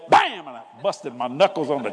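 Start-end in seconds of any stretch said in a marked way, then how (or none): 0.1-0.5 loud, high bam; bam sound effect. Retold or imitated. Retelling the arm wrestle, loud high bam. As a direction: slam it out like a fist on a table. Knuckles slammed on table.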